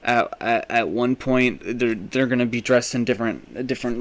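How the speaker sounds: ordinary talk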